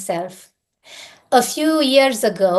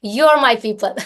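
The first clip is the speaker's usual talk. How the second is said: ordinary delivery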